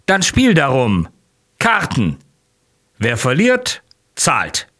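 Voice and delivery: reckless gambler. A narrator's reading. Nozdrev challenges him to cards.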